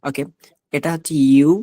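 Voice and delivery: usual speaking voice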